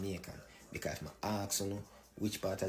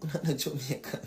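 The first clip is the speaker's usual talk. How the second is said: laughing; 6 semitones higher than usual. Real, not acted. Laughing dismissal.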